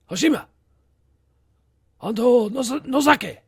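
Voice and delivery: high, loud mock Japanese accent. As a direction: call the names in a hammed-up Japanese accent. Calling names in mock accent.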